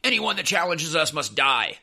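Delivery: mock menace of a petty tyrant; mocking Sam Adams. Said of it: "he's like".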